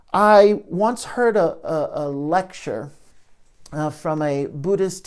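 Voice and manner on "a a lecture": ordinary delivery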